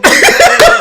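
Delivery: Fuck you, Beto yelled out loud and high; mock yell. Imitating Toño yelling at Beto, loud mock yell. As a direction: belt out the insult at full volume, YOU punched hardest. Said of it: "like, like that one".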